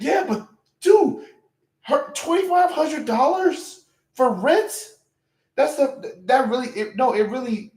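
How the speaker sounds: stunned, shouted outrage at the rent; outraged disbelief. Real, not acted. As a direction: yell it like you can't believe the price. Streamer outraged at rent price.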